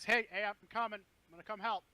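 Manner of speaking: Mushroom shouting up. Acted. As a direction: call up to friends that help is coming. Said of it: "gonna shout up to you guys".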